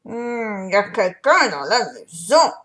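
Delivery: loud, alarmed papa bear; papa bear exclaiming loudly at the door. A narrator's reading. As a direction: call out that someone's inside. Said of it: "s'exclama-t-il".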